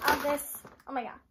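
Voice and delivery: usual speaking voice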